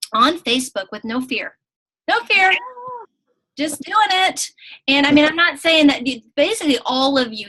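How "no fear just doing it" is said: high, raised-voice cheer; punchy, high-pitched hype; rousing cheer. Real, not acted. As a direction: cheer on the new coach. Cheering on a new coach.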